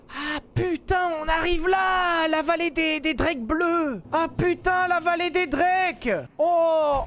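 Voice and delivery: thrilled gamer yelling at a new area; excited shout. Real, not acted. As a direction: call out the discovery in awe. Arriving at the drake valley.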